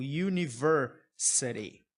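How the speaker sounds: "university" devagar, começando com iu; som de iu. Shown as a demonstration. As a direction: diga "university" bem devagar, com iu no início. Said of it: "o som é de U". (university com som de consoante)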